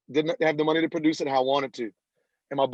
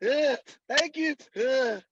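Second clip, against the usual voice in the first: high, slow, flustered thank you; overwhelmed and tongue-tied, much higher; a hammed-up speechless reply; stunned stammer; 9 semitones higher, slower than usual. Retold stunned reply to producer.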